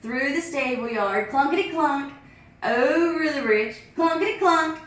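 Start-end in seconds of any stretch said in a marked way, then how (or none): none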